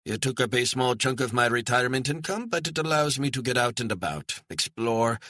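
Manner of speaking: a hint of a proud German retiree. A narrator's reading. German retiree telling Stone about his RV, lightly voiced and proud. Gerhard's voice, held.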